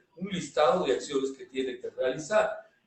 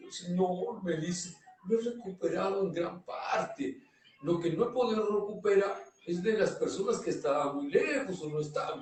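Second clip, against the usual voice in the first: soft, slightly raised imitation of a worker; retold meek excuse; 3 semitones higher, 6 dB quieter than usual. Worker explaining missing material to the boss, meekly. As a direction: quietly make excuses as the employee.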